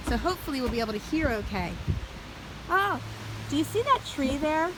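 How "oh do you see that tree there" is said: ordinary delivery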